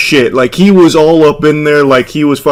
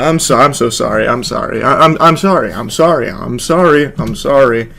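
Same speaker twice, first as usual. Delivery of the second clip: softer, sorry on loop; mock repeated apology; 3 semitones lower, 3 dB quieter than usual. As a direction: keep repeating sorry, quieter each time. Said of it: "that's his new, like, go-to line".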